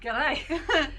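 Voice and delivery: playful go at an Australian accent. Shown as a demonstration. Co-host echoes her friend's attempt, laughing Australian accent. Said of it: "my Australian accent".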